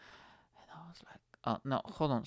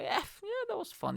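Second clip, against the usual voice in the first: high falsetto pretend cheer; badly faked delight, pitched way up; faked enjoyment. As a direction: claim fun in a squeaky, unconvincing voice. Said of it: "I was not showing that".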